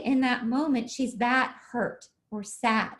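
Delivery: ordinary delivery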